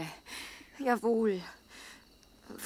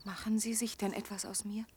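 soft, hesitant asking; timid question; 9 dB quieter than usual. Acted. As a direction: ask almost afraid of the answer. Timid question after apology. (leise, zaghafte Frage)

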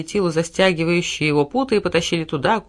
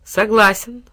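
usual speaking voice

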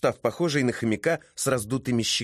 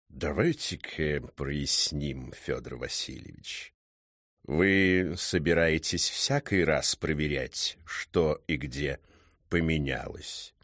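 low, soft, very slow cat voice; deep, measured drawl of a learned cat; stern talking cat; 7 semitones lower, 4 dB quieter, slower than usual. Acted. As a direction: interrogate with slow, deliberate menace.

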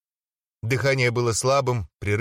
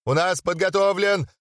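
higher, slow, showman; hyped announcement; 8 semitones higher than usual. Acted. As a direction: tease the prize to the crowd. Завопил Отто Кюхелькен.

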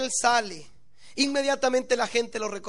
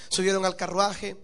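normal talking voice

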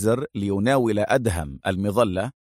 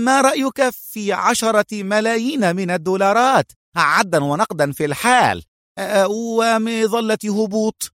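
high, stammering, anxious offer; nervous bargaining; 10 semitones higher than usual. A narrator's reading. Eliezer offers Adham millions to bargain, nervous and high. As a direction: tempt him nervously, dragging out the last words.

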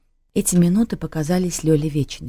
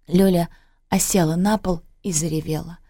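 usual speaking voice

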